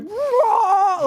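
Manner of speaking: very high startled cry; astonished whoa. Retold or imitated. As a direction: yelp in disbelief at your strength. Lifting trunk in shock.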